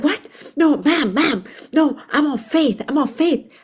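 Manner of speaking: high, loud, hurried protest; imitating a rattled man brushing her off; flustered refusal. Supervisor waving off her prayer offer, flustered and high.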